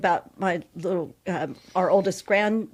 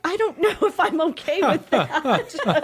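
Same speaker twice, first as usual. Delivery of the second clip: laughing; 9 semitones higher, 5 dB louder than usual. Retold or imitated. Her own remembered reaction.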